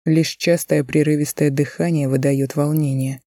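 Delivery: usual speaking voice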